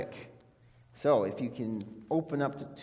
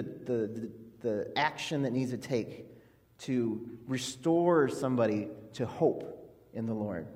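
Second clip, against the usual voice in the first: ordinary delivery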